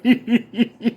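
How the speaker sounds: long high staccato giggle; imitated laughing fit. Rapper cracking up at a corny joke, imitated laughter. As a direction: wheeze with mock helpless laughter. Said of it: "the way he was laughing".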